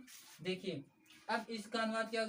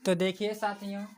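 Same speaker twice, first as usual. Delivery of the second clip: much louder rallying call; 9 dB louder than usual. Real, not acted. Teacher loudly calling students back to the lesson.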